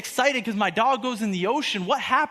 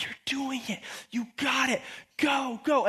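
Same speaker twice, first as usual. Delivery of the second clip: high, hushed-then-rising cheer; excited encouragement; 4 semitones higher, 4 dB quieter than usual. Acted. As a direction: urge a friend on with eager delight. Christ cheering Peter on, acted out.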